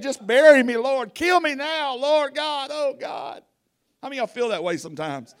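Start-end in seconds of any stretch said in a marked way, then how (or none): 0.0-3.4 loud, raised begging to the Lord; acted weeping sinner crying out; anguished pleading. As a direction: plead loudly to be taken. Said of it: "Tears are flowing and snot's flowing".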